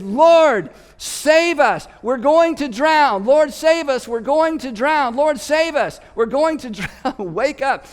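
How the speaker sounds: loud, high, desperate pleading shouts; panicked yelling. Retold or imitated. Terrified disciples shout at sleeping Jesus to wake. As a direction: scream for help over the wind. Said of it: "Everyone is yelling".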